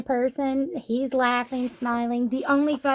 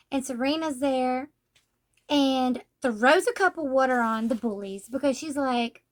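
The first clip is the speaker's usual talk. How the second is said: ordinary delivery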